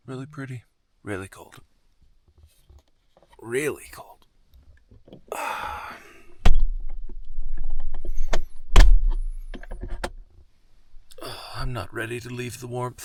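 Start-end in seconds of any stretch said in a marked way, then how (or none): 3.4-4.2 breathy whisper in the cold. Real, not acted. Repeats words, second time whispered. 4.5-10.4 long loud breath out into cold; cold air breath. Real, not acted. Long breath out in freezing morning.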